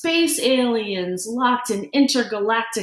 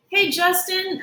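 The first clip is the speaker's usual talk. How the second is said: calling out; 10 semitones higher than usual. Acted. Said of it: "Emilio calls out".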